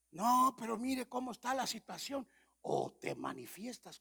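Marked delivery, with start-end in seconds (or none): 0.1-2.3 complaining believers. Retold or imitated.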